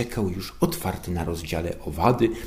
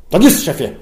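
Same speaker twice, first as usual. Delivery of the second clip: loud, higher eager boom; 10 semitones higher, 12 dB louder than usual. A narrator's reading. Underling answering his boss with a loud boom. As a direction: bellow it like a keen soldier. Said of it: "huknął".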